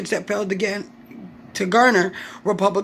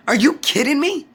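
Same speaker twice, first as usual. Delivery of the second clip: higher, louder disbelief; incredulous outburst; 6 semitones higher, 4 dB louder than usual. Real, not acted. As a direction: throw the question back in disbelief. Angry rant at politicians.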